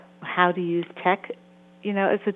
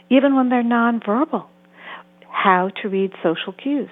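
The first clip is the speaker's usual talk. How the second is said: normal talking voice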